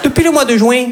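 put-on high, singsong Midi accent in a New York romcom; sunny accent du Midi; southern French accent. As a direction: play a romcom lead with a thick Marseille accent. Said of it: "avec l'accent du Midi".

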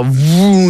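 long rising "vroom" of acceleration; mouth-made speeding noise; imitated engine whoosh. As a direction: voice a car zooming away.

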